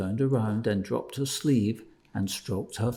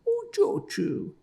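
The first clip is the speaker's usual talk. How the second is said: high, slow, gentle calling; slower than usual. A narrator's reading. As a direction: say her name as gently as you can. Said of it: "he said again, as gently as he could".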